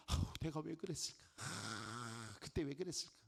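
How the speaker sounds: muttering low at yourself, a touch of disgust; lightly voiced self-blame; self-reproach. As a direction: scold yourself under your breath. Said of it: "자기가 자기한테 말합니다".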